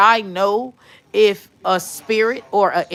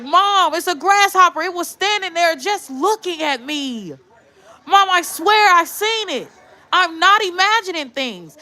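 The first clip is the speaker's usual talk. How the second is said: put-on high-pitched kid insisting to a parent; imitating pleading son; 9 semitones higher than usual. Son swearing to mom he saw it, high and pleading. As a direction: act out a child begging to be believed.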